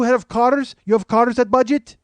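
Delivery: high, loud, questioning fake Russian accent; mock Russian accent. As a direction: ask like an eager Russian stranger, accent laid on thick. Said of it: "He's a Russian guy".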